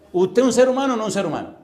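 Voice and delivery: loud, high pressing question; forceful challenge. Real, not acted. Preacher challenging a listener's honesty, loud and high.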